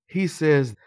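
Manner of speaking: ordinary delivery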